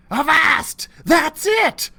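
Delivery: loud, high pirate cry; triumphant yell of a pirate kid; excited shout. A narrator's reading. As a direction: burst out with the discovery. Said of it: "I shouted".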